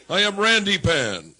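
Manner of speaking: loud, slow mock-mythic creature voice; hammed-up goat boy proclamation; booming goat boy. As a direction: announce yourself as a randy goat god. Stage persona bit, booming.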